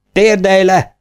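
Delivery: high, loud barked command; shouted order. A narrator's reading. Officer shouts at the blindfolded brother to kneel. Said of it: "Kiáltotta a tiszt".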